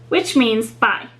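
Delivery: ordinary delivery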